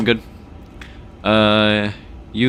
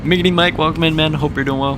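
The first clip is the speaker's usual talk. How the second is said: excited greeting; 9 semitones higher than usual. Real, not acted. Greeting a viewer in chat.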